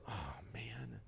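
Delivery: breathless whispered defeat; whispered groan. Retold or imitated. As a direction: sigh it out under your breath. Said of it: "not goes".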